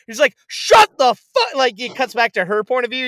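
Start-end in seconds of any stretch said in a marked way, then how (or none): 0.5-1.5 high, loud, drawn-out mock outburst; freaking out. Retelling the detective snapping at his parents, freaking out. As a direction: act out a man blowing up. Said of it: "He's, like, freaking out".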